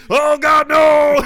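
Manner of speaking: loud, high hammed-up cry of defeat; overpowered hero bellowing no; mock anguished yell. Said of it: "he's like".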